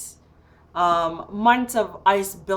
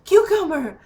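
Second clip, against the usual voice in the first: high, louder call to a pet; retold calling out; 9 semitones higher, 5 dB louder than usual. Locked-out woman to her cat, high urgent calling.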